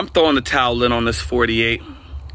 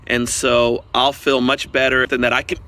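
ordinary talk, nothing marked

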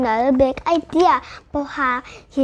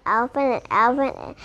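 plain reading voice

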